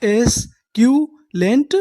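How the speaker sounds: एस्क्यूलेंट stretched slowly with a rise; slow sounding out. Shown as a demonstration. Teacher answering his own question, slow. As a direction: draw एस्क्यूलेंट out. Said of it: "उन्हें हम लोग क्या बोलते हैं English में?".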